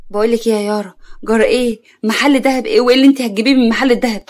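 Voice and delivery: plain reading voice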